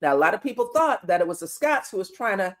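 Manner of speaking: ordinary talk, nothing marked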